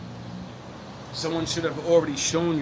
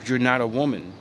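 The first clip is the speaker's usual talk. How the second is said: usual speaking voice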